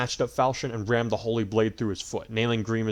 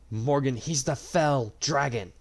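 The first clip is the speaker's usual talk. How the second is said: sharp hiss; 4 semitones higher, slower than usual. A narrator's reading. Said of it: "Marth hissed sharply".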